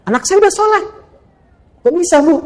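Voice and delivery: excited mother. Retold or imitated. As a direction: call out the happy news from a distance.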